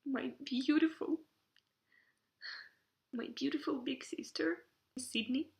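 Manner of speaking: tearful, words breaking up; crying. Real, not acted. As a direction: voice cracking high with tears. Tearful while naming host family.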